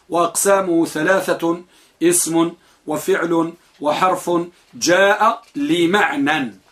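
slow recitation. Acted. Reciting the author's text.

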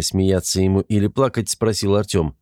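ordinary delivery, nothing marked

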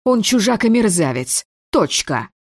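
ordinary delivery

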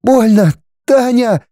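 высокий жалобный женский голос; проскули высоко, как от боли; скуля, плача. A narrator's reading. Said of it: "проскулила она, плача". (плаксивый болезненный возглас)

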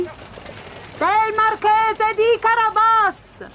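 very slow, high chanted answer; reapers answering in chorus. A narrator's reading. Reapers answer the king together, high drawn-out chorus call. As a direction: sing it out like a whole group of peasants. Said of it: "risposero in coro i mietitori".

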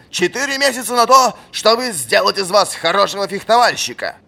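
high, loud exasperated tirade; furious old master. A narrator's reading. Old fencing master raging at the captain. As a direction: rage at the lazy pupil.

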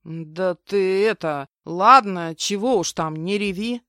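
awkward, halting grumble; gruff consoling. A narrator's reading. Henchman soothing the crying woman, gruffly. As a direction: grumble some clumsy comfort. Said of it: "буркнул петуха".

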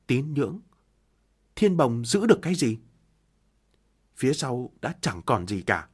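plain reading voice

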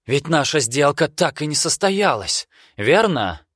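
put-on high, pressing young man; tense young man. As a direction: press him for the truth. Vester voiced in story.

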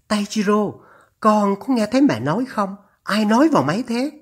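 high-pitched, insistent mother on the phone; alarmed mother. Acted. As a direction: demand answers as an anxious mother. (giọng mẹ cao, gặng hỏi)

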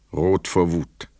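normal talking voice

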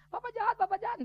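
high-pitched imitation of a protesting child; complaining child. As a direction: mimic a child accusing a parent. Child's complaint voiced by father.

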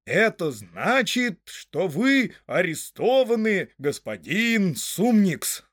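loud, high, very slow pompous announcement; solemn proclamation. A narrator's reading. Sheriff chief arrests Frodo, loudly and solemnly. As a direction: proclaim each word slowly and grandly. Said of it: "торжественно произнёс начальник шерифов".

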